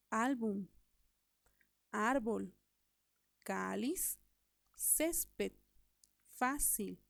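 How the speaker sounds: álbum, árbol, cáliz, césped, fácil, each leaning on the first syllable; spaced list álbum, árbol, cáliz, césped, fácil, first syllables stretched; stress on penultimate syllable. Shown as a demonstration. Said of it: "tienen la elevación de voz".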